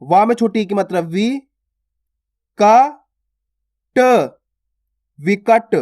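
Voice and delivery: teacher building विकट from isolated sounds; pronunciation demo. Shown as a demonstration. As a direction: sound out विकट piece by piece, then blend. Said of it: "देखो किस प्रकार से उच्चारण हो रहा है".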